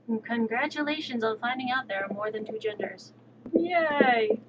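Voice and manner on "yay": sarcastic cheer. Pretend. Sarcastic cheer about genders.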